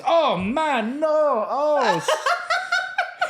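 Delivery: a high, hammed-up Ghanaian wail, breaking into laughter; mock panic. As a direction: fall to pieces in a Ghanaian accent. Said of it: "went all to pieces".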